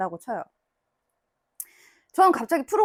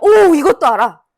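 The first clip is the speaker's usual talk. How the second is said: slow, much louder, beaming "wow, you know this?"; delighted praise; 3 semitones higher, 11 dB louder than usual. Shown as a demonstration. Teacher showing parents how to praise: slow, loud delight. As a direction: cheer the kid on, drawing it out. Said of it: "이렇게".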